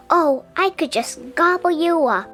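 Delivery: ordinary delivery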